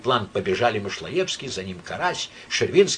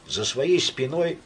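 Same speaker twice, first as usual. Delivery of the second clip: ordinary delivery, nothing marked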